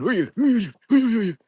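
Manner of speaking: high, loud repeated demand; retold eerie voice. Ghost hunter imitating a voice heard in the dark, high and loud. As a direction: mimic the unseen voice calling out. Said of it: "I could hear someone going".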